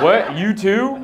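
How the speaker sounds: high, affronted mock disbelief; incredulous dismay. Hotel guest seeing someone else in gym, high incredulous dismay. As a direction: pitch it up in comic disbelief.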